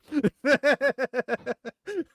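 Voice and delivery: loud, high burst of laughter; real laugh. Real, not acted. Laughing at their own Welsh joke, real high laughter.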